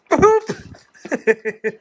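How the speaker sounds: high, playful little boop. Real, not acted. Man booping his dogs at bedtime, playful high boop.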